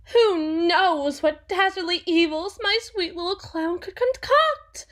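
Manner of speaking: mock-ominous, rising to a squeal at the end; mocking taunt. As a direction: tease him like a spooky story for a child. Mocking threat about the jester.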